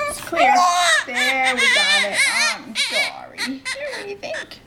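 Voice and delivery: crying and laughing at once; overjoyed, voice cracking with tears; laughing through tears. Real, not acted. Emotional birth of newborn.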